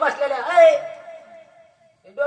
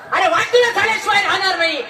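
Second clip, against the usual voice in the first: higher, louder proclaimed curse; called-out curse; 6 semitones higher, 4 dB louder than usual. Retold or imitated. Wife cursing the mocking villagers, loud. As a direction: fling the curse out at the crowd. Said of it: "आवाज दिला".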